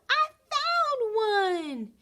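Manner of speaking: very high, slow, drawn-out delighted cry; excited cry. A narrator's reading. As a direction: sing it out, thrilled at the find. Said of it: "Dottie cried".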